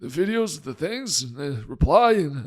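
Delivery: put-on George Bush as an old man. Doctor mocking himself on TikTok replies as an old man. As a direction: put on a doddering hundred-year-old man. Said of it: "I sound like a hundred-year-old man".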